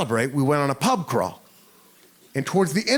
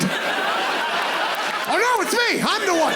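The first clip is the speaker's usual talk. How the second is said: high, squeaky panicked yelp; panicked realization; much slower than usual. Retold or imitated. Big American realizing he'll get beaten, panicked squeak. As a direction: suddenly realize you're the victim, voice jumping up.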